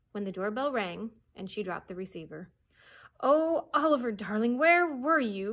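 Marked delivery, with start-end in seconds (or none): 3.2-5.5 loud relieved cry, pitched high. Acted. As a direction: call out to the lost boy in relief. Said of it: "cried Mona's voice".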